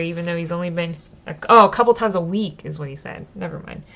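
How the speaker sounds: normal talking voice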